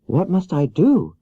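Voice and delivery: put-on little girl pleading for help; young Dorothy. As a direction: play Dorothy small and meek, pitched high. Dorothy asks.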